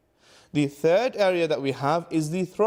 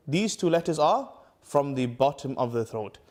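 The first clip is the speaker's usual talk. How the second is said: ordinary delivery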